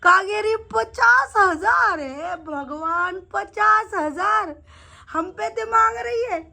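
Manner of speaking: high, drawn-out mock astonishment; amused disbelief. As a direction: stretch the words out in amused shock. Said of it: "हंस हंस के कहता है". (हँसी भरे अचरज में, ऊँचा और खींचकर)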